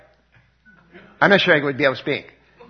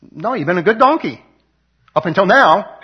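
higher, louder mock-grudging master; grudging Balaam; 5 semitones higher, 4 dB louder than usual. Preacher voicing Balaam to the donkey, higher and louder with a grudging edge. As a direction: play Balaam admitting it through his anger.

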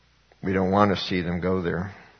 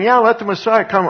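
much higher mock careless voice; mocking scoffers; 12 semitones higher, 4 dB louder than usual. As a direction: mimic the careless crowd, high-pitched. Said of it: "people were saying".

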